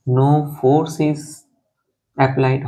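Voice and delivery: drawn-out, very slow repeat; very slow dictation. Shown as a demonstration. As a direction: stretch each word out for writing. Teacher repeats it for note-taking.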